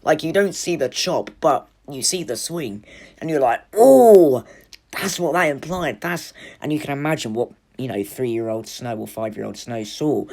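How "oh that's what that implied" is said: surprised realisation. Retold or imitated. Realising what the swing implied.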